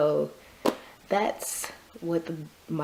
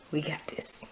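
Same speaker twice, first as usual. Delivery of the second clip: whispering; 8 dB quieter than usual. Real, not acted. Reassuring the listener softly.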